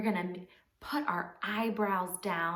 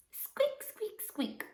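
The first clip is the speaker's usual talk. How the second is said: very high, tiny squeaks; mouse squeak; 13 semitones higher, 4 dB quieter, much slower than usual. Pretend. Leading children in mouse sounds, tiny high squeaks. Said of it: "A mouse goes squeak".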